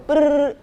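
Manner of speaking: high, loud lip-trill beat noise; trilled brrr. Retold or imitated. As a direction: buzz the lips like a drum roll. Beat sound between name ideas.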